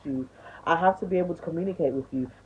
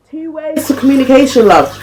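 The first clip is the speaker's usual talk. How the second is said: higher and much louder, driving it home; loud emphatic point; 8 semitones higher, 14 dB louder than usual. Real, not acted. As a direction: shout the lesson out. Ranting about communication.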